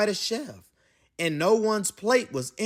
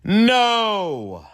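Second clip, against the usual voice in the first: loud, higher, drawn-out exclamation; emphatic cry of no; 5 semitones higher, 7 dB louder than usual. A narrator's reading. Narrator reveals napkins made it worse, loud dismayed no. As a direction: burst out with a big storybook no.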